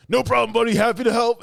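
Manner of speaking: high, loud, chipper big guy; cheery hero voice. Retold or imitated. Hero cheerfully reassuring the mime, high and loud. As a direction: boom it out like a friendly hero. Hulk Hogan line retold.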